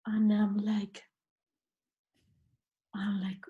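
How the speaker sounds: breathy stunned whisper; whispered aside. Retold or imitated. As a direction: hush it, stunned. Speechless after the old man's words.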